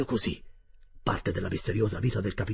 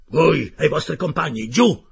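loud, raised-pitch sharp command; curt order; 5 semitones higher, 9 dB louder than usual. A narrator's reading. Captain sends the narrator and companions below, curt loud order. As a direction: order them below, peaking on the last word. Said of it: "mi ordinò seccamente".

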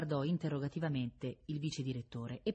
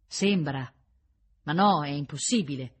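restrained shout; 7 dB louder than usual. A narrator's reading. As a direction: call it out over the noise. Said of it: "si mise a gridare".

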